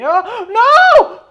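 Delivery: sudden high shriek of dismay; shocked scream. Real, not acted. Player screams when his character suddenly dies, shocked shriek.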